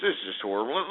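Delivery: ordinary talk, nothing marked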